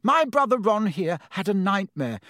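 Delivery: puffed-up, high-pitched explaining; indignant. A narrator's reading. Percy defending himself to his professor, indignant. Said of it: "puffing himself up indignantly".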